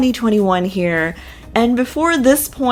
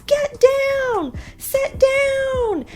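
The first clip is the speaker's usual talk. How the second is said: mock yelling; much slower than usual. Robin calling her kids off a big rock, high mock yell. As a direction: imitate a shrill parent yell, drawing out the last word. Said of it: "Robin's yelling at her kids".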